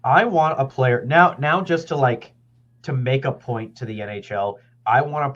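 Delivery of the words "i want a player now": normal talking voice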